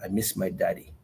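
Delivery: soft, quiet, grief-struck; a hushed, broken repeat, fading away; retold tearful plea. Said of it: "all I could say is".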